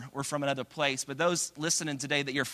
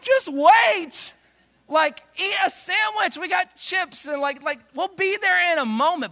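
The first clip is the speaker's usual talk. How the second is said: much higher, louder pleading; pleading parent; 13 semitones higher, 7 dB louder than usual. Retold or imitated. Dad to toddler wanting the water, high loud pleading.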